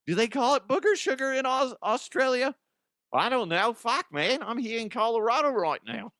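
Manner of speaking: high, rowdy party-guy voice; Ozzy voice. Acted. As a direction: pitch it up and beg for booze. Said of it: "Ozzy DeHuff".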